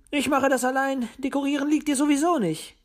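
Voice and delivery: high-pitched, needling little owl; high, bickering owl retort; dismissive owl. A narrator's reading. Owl Willi answers Paul dismissively.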